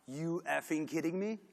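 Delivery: slow, incredulous you kidding me; stunned disbelief. Retold or imitated. Designer seeing the awarded logo, slow stunned disbelief. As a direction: let it drag out in disbelief. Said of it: "And I was like".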